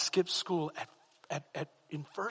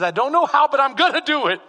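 higher and much louder, furious yell; angry shout; 8 semitones higher, 11 dB louder than usual. Retold or imitated. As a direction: blast it out at the boy. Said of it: "He says".

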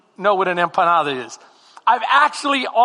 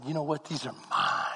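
possessive murmur; 6 semitones lower, 12 dB quieter than usual. Retold or imitated. Hoarding empanadas.

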